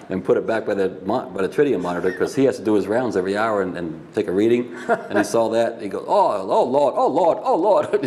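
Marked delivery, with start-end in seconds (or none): 6.1-8.0 high-pitched Virginia-accented wail of mock dismay; exaggerated Southern panic, Virginia accent; deep Virginia accent. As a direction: imitate a flustered Virginian, pitch jumping up. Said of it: "with that deep Virginia accent".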